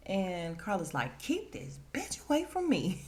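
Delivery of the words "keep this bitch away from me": mock angry outburst. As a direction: imitate an exasperated, pitched-up demand. Quarrel, demanding she be kept away.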